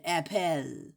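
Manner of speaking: "apple" as ap-PELL; spelling pronunciation. Shown as a demonstration. Explaining E L at the end, "apple" as ap-PELL. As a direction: sound the E before the L in "apple". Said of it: "Because E L is at the end".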